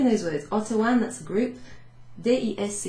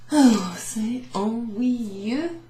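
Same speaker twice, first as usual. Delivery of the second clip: very slow, loud bored drawl; mock boredom; 3 semitones higher, 6 dB louder, much slower than usual. Shown as a demonstration. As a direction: stretch out the boredom. Langeweile übertrieben vorgespielt.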